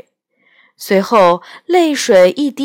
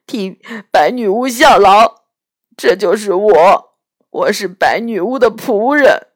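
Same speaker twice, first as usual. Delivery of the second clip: a lightly voiced tearful faun, louder; weeping faun; 6 dB louder than usual. Faun confessing his wickedness to Lucy, tearful faun voice. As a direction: play the ashamed faun in tears.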